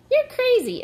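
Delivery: very high, loud squawk; arguing shout. A narrator's reading. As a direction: squabble at the top of the voice. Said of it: "They were shouting louder and louder".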